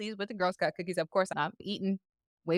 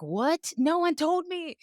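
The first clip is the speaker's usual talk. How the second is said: mock surprise; 9 semitones higher, much slower than usual. Retold surprise at cookie news.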